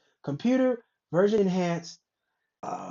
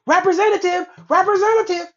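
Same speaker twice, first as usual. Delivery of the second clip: much higher, loud exasperated caller shout; yelling at phone robot; 11 semitones higher, 9 dB louder than usual. Acted. Fed-up caller demanding a human from the phone bot, yelling. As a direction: shout it at the automated system, punching every syllable.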